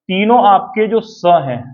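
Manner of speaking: usual speaking voice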